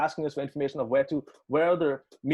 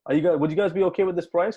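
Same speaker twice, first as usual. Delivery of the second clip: louder, higher pressing question; insistent retold question; 5 semitones higher, 6 dB louder than usual. Promoter presses the musician on the price, insistent. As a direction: press for an answer, voice lifted.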